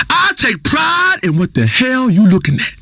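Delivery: usual speaking voice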